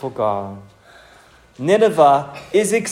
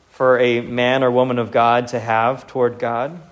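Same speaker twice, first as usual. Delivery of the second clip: ordinary delivery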